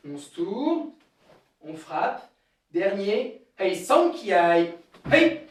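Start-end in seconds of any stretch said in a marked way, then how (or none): 3.6-5.4 slow loud count into kiai; counting shout. Real, not acted. Karate teacher calling the final count to the class. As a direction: call the last count then shout.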